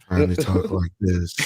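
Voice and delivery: a hint of her affected interview voice; mock put-on voice. Mocking how the actress talks in interviews, lightly put on. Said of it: "She actually talks like this".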